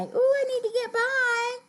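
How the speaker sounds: very high, sing-song polite plea; polite request. Acted. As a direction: ask to pass politely in a high little voice. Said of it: "That is the polite way to say".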